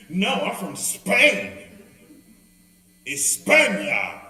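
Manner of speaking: slow, drawn-out haughty España; a hammed-up proud Spaniard, stretched slow and loud; proud Spanish lady.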